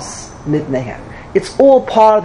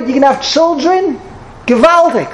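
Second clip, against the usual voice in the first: high, gushing mock congratulations to the bride; tactless gushing guest; 6 semitones higher than usual. Wedding guest to the bride, gushing and tactless. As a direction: overeager guest, much higher, blurting bad news cheerfully. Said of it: "Is that how you talk?".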